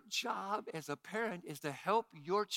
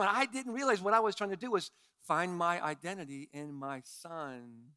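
normal talking voice

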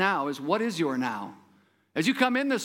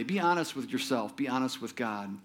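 softer, sincere urging; earnest soft appeal; 5 semitones lower, 5 dB quieter than usual. Real, not acted. Preacher urging the congregation to honesty, soft and sincere. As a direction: lean in and speak it gently to the heart. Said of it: "preacher urging congregation".

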